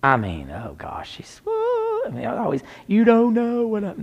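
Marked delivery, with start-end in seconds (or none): 1.4-2.0 high hammed-up "whoa"; mock a drama queen's high wail. Preacher sends up mom the drama queen, high mock wail. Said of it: "My mom's a drama queen". 2.9-4.0 high, loud hammed-up complaint; drama queen. Preacher mimics mom the drama queen, high mock complaining. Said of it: "My mom's a drama queen".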